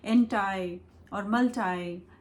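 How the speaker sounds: एंटी and मल्टी ending like "eye"; American pronunciation. Shown as a demonstration. Teacher recapping for viewers, एंटी and मल्टी with "tie" endings. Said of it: "America में कैसे बोलते हैं".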